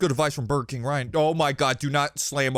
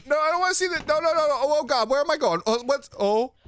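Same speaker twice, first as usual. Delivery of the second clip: high, rapid, pleading noes; real panic; 13 semitones higher, faster than usual. Real, not acted. Gamer panics as the monster comes near. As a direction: beg not to see it, voice climbing.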